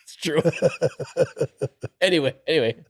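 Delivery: high-pitched, talking through laughter; laughing. Real, not acted. Coworker teased about his mistake, laughing. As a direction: try to move on while still laughing.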